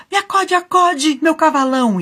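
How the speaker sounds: panicked scream for rescue; desperate shout. A narrator's reading. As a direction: yell for the horse as you lose the fight. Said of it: "Aí ele gritou bem assim".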